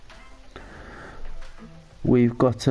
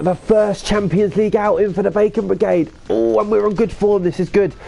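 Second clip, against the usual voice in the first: excited; 8 semitones higher than usual. Real, not acted. Hyped about first Champions League outing.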